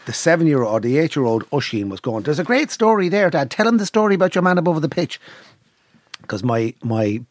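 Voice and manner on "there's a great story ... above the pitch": put-on higher-pitched, eager child urging dad; lightly voiced boy pleading for a story; excited nephew. Nephew at the table begging dad to tell it, excited.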